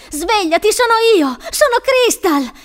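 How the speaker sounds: high, loud desperate cries; yelling. A narrator's reading. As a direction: shout to wake someone, slowing at the end. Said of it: "urlava Crystal".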